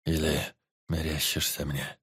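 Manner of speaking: slow, low, fading question; weak forester. A narrator's reading. Dying forester asks the teacher, slow and low. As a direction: ask as if barely able to see him.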